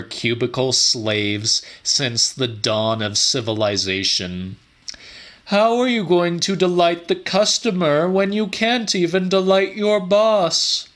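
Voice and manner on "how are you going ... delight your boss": a lifted, higher voice for the scolding boss; higher-pitched voice of a patronizing manager; smug boss. A narrator's reading. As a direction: play the condescending supervisor, pitched up. Narrator voices boss Jerry.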